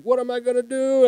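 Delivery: high, loud mock wail; retold crying. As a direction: wail it as if re-enacting your tearful despair. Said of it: "like, crying".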